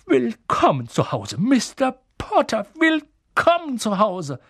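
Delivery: slow, high welcome swelling with emotion; overcome, drawing out each greeting; tearfully moved. A narrator's reading. Old barman greeting Harry by the hand, tearfully moved. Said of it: "mit Tränen in den Augen".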